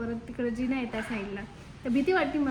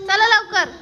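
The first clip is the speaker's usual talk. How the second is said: loud, high order to hurry; sharp call; 6 semitones higher, 10 dB louder than usual. Real, not acted. Mother yelling at the kids to come down, loud.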